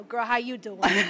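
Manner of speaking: loud, drawn-out raspy mom voice; resentful mom character. Retold or imitated. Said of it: "this resentful mom thing".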